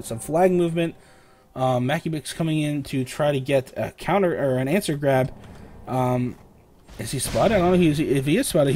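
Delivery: ordinary talk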